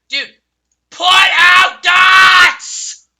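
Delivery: very slow, high, screamed order; raging shout. Real, not acted. Gamer screaming at teammates, high raging shout. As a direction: scream it at the top of your lungs.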